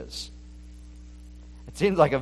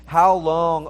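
crying out; 4 semitones higher, 7 dB louder than usual. Retold or imitated. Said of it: "they're crying out in Revelation six ten".

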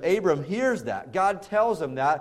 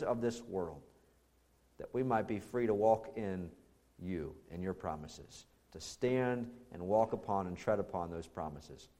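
hushed, earnest prayer voice fading softer toward the amen; 5 semitones lower, 4 dB quieter than usual. Acted. Pastor praying over the congregation, soft and earnest. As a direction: pray it quietly to the Father, winding down.

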